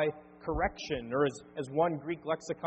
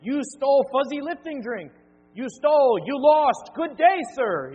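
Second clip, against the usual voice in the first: high, loud furious rant as Willy Wonka; furious shout; 9 semitones higher, 12 dB louder than usual. Retold or imitated. Pastor mimics Willy Wonka yelling at Charlie, furious shout. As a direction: explode with rage at the boy. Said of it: "Willy Wonka gets really emotional".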